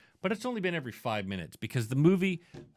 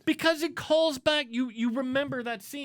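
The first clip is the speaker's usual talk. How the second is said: much higher, worked-up arguing; heated insistence; 12 semitones higher than usual. Real, not acted. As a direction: push the point hard, voice climbing. Heated argument about the movie.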